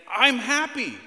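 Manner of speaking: high, loud, joyful burst; happy exclamation. Shown as a demonstration. Dad showing children the happy tone, exuberant shout. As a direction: shout it out with genuine-sounding delight. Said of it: "and saying".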